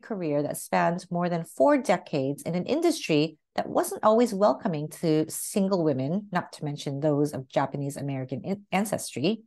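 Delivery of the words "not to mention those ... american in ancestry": plain reading voice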